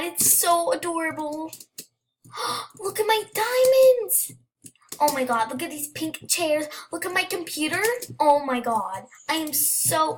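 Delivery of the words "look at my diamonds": gasp, then high and loud with delight; squealing at the sparkly diamonds; excited gasp. Real, not acted. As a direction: gush over the treasure in a high, loud voice. Discovering her new dorm room.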